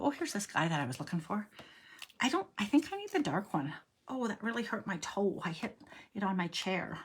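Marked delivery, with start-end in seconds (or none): none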